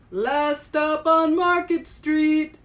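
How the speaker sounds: slow, loud, high call down the bus; driver calling out. Acted. Bus driver calling the last stop to his passengers, loud and slow. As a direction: announce the stop to the whole bus. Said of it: "Mister Dennis called".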